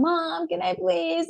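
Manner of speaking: put-on high, drawn-out kid whine; whining child. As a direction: imitate a child whining for something. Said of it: "just like the whining".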